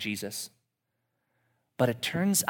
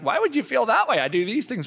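high, loud defensive outburst; hammed-up disbelief; mock indignation; 11 semitones higher, 8 dB louder than usual. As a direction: play the wounded boss. Voicing defensive leader.

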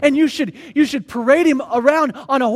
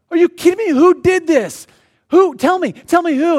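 higher, louder, demanding who did it; outraged king; 4 semitones higher, 3 dB louder than usual. Acted. King demands of Esther who threatens her people, outraged. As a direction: fire off the questions in outrage.

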